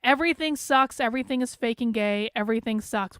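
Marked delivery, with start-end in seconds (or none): none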